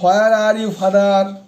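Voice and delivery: solemn poetry reading with long pauses between phrases; poem recitation. Acted. Recites own anti-war poem.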